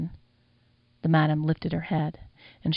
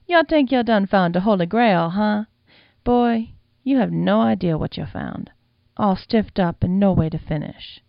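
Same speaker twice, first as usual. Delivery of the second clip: put-on louder, knowing old madam; amused madam; 3 semitones higher, 5 dB louder than usual. The madam mocking the boy's find, louder and knowing. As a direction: lay it on him like a wise old woman.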